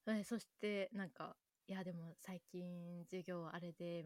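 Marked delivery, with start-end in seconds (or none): 1.7-4.0 softer, slow, hushed chat; quieter voice. Retold or imitated. Friends lowering their voices at dinner, quiet and slow. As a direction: keep it low so nobody overhears. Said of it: "結構静かめの声で話し始めたんよ".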